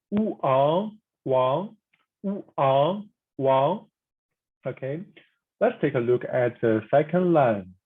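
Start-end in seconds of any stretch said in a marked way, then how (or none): 0.1-3.8 "wu ang wang" slowly, each syllable rising, "wang" clearly lifting upward at the end; rising tone demo. Shown as a demonstration. Teacher drills the surname Wang for students, slow rising tone. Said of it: "but the rising tone".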